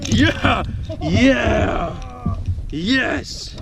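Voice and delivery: loud, high victory yells; triumphant cheer. Real, not acted. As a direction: celebrate a big catch. Celebrating the landed fish.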